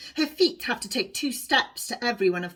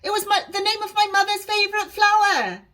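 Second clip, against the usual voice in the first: put-on high, loud girl's voice; calling out; 12 semitones higher, 6 dB louder than usual. A narrator's reading. Honesty calls to Alice about her name. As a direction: call it out eagerly.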